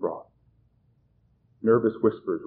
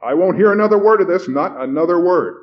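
loud, high, indignant outburst; stern; 8 semitones higher, 10 dB louder than usual. A narrator's reading. Stern middle-aged man interrupting the meeting, indignant. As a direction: lay down the law at full voice. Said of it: "a stern, middle-aged man".

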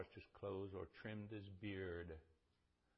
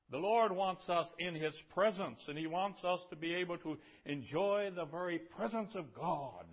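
loud, high, driving pulpit voice; full-force preaching; 8 semitones higher, 12 dB louder than usual. Real, not acted. Preacher telling the church God wants them near, loud and impassioned. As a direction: proclaim it to the whole congregation at full power.

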